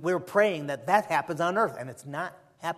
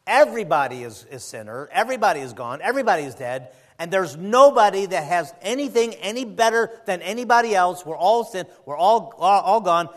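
preaching; 3 semitones higher, 8 dB louder than usual. Acted. Preaching at full force.

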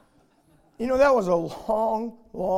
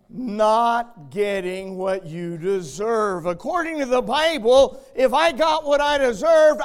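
preaching at full force; 3 semitones higher, 7 dB louder than usual. Acted. Preacher to his congregation about deserving hell, full-force shout. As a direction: thunder it from the pulpit. Preacher drives home mercy.

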